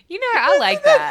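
high, loud laughing speech. Real, not acted. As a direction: laugh while saying it. Laughing through words.

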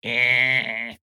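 a gruff, dismissive mouse grunt; curt grunt. Acted. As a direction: grunt it like you can't be bothered. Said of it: "And Sneck goes".

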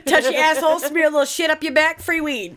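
high, loud mocking hustler; hyped dealer pitch. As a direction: sell it like a sleazy dealer.

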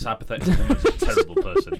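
high, loud, fast laughing line. Real, not acted. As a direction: squeal it through a laugh. Laughing at the hypothetical woman.

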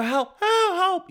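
mock granny screaming help from behind the window; grandma yelling. Comic telling a hero fantasy, imitating a trapped grandma's high cry for help. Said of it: "You know how grandmas yell".

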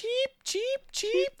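very high, soft chirping voice; mock baby bird begging for food. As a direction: chirp like a hungry chick. Imitating baby birds.

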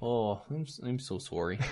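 soft, apologetic Canadian sorry; Canadian accent, quiet and sheepish. Retold or imitated. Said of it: "he's not that Canadian".

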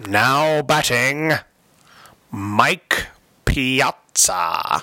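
slow, drawn-out ballpark announcer; stadium announcer. Pretend. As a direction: boom it out like the stadium PA. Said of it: "I want you to say".

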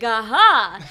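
loud knowing aha; the penny drops, out loud. Retold or imitated. Realization on second viewing.

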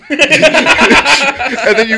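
high squeal of laughter through the words; can't stop laughing as she tells it. Real, not acted. Laughing while telling the story.

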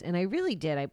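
ordinary delivery

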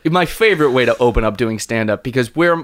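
ordinary talk

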